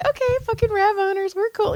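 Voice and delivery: high, laid-back bro voice; mock bro voice. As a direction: nod along like a fellow rav owner. Retold reply to stranger.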